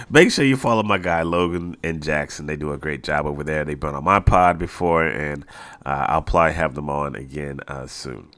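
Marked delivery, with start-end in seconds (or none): none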